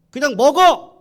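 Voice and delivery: a furious official yelling at captives; shouted order; angry shout. Acted. Chief scolding the boys.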